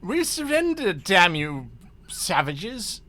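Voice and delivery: defeated captain surrendering. Acted. Voices the defeated ship's captain.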